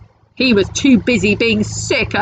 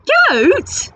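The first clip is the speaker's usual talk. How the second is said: much higher rising Goat?; disbelieving squeak. Acted. Pirate chief hears the troll offer goat stew, high disbelieving squeak. Second take, louder disbelief.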